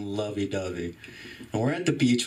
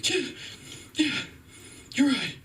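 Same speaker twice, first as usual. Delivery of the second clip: high-pitched, mock-sad friend; sad buddy; 12 semitones higher than usual. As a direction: imitate a guilty buddy giving in. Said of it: "is really sad because he's cheating".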